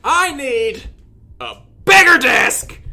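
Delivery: ordinary delivery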